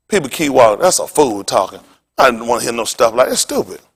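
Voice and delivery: ordinary talk, nothing marked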